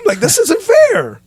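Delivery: high, laughing mock whine; whiny complaint to God. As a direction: squeak out a hammed-up complaint to the Lord. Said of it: "I'm like".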